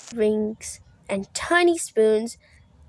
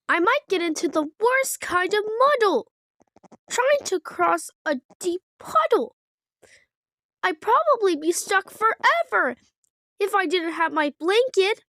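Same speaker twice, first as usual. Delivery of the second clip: higher, quick anxious little frog; worried baby Kermit; 8 semitones higher than usual. Acted. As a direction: fret aloud like a small frog. Kermit keeps worrying.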